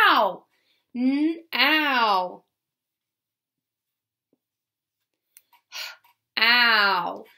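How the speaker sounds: ow as in now; ow sound. Shown as a demonstration. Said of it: "sometimes the O W says".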